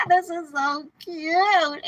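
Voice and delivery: high, loud and slow gushing over the card; laughing, gushing at a cute card; delighted squeal. Real, not acted. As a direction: squeal over something adorable. Delighted at the Seven of Swords.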